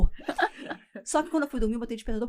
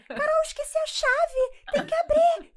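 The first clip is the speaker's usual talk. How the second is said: disguised woman's voice; 5 dB louder, slower than usual. Retold or imitated. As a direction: fake female voice, much higher, slow and pleading. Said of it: "Se eu falar com a minha voz, vai vazar". (voz feminina fingida, bem aguda, lenta e aflita)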